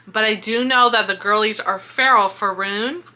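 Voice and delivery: normal talking voice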